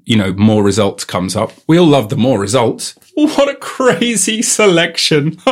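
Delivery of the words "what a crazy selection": high, slow, drawn-out gushing; hammed-up wonder, stretched out; mock amazement. Gushing over more results, mock amazement.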